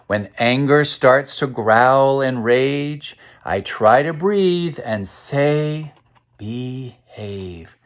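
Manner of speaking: measured, sing-song rhyming read-aloud; reading to children. A narrator's reading. Teacher reading the lion book aloud to kids, rhythmic storytime voice. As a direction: read the verse to the class with a steady beat.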